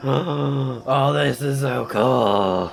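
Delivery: ordinary talk, nothing marked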